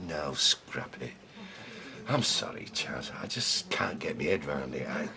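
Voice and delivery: low, subdued giving up; dejected apology. Acted. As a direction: drop low and soft, defeated.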